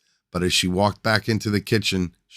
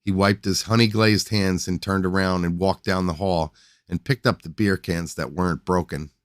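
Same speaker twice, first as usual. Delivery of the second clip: ordinary delivery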